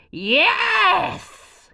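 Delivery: high, loud joyful yes; cried yes. Acted. Witch delighted with her new broom, joyful cry. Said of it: "cried the witch".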